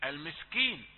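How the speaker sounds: usual speaking voice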